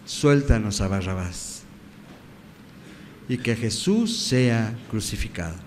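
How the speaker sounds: normal talking voice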